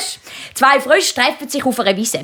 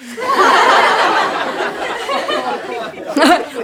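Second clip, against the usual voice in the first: high laugh at own joke; real giggle, much higher; cracking up after the parrot joke; 11 semitones higher than usual. Real, not acted.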